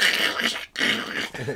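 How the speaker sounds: voiceless crunching mouth noise; mouth-made rustle and crunch; imitated crackling noise. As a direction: crackle like someone creeping through dry brush. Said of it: "like, crackling noise".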